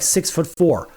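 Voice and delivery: ordinary delivery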